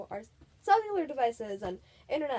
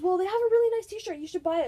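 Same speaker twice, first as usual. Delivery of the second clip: much higher, louder eager mom; insistent mom; 8 semitones higher, 9 dB louder, faster than usual. Retold or imitated. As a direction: push it on her cheerfully. Mom urging daughter to buy.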